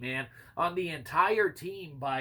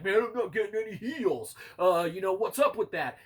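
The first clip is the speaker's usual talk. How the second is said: higher, louder imitation of an aggrieved player; mock complaint; 4 semitones higher, 4 dB louder than usual. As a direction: imitate a teammate griping about healing. Mimicking a whining teammate's complaint.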